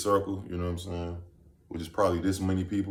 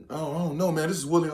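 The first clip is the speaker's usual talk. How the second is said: lightly voiced girl; 8 semitones higher, faster than usual. Girl voiced in retold story.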